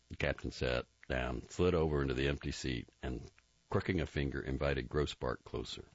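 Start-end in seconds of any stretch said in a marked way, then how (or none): none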